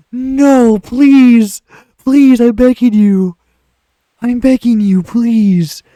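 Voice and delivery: high, loud wailing plea; desperate begging. Real, not acted. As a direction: beg at the top of your voice. Begging the crashed game.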